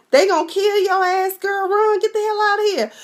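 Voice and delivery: high-pitched, hammed-up alarm; urgent mock warning. Warns the character to flee.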